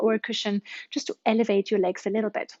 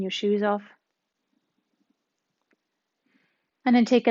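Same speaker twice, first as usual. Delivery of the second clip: guided meditation. Acted. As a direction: ease them into relaxation. Leads a guided relaxation.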